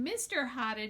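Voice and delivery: plain reading voice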